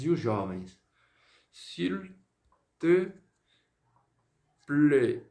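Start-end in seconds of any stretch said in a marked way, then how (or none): 1.5-5.2 each word set apart, louder; s'il te plaît dito palavra por palavra; slow spelled-out s'il te plaît. Shown as a demonstration. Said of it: "Esse é o por favor informal". (pronúncia pausada de s'il te plaît)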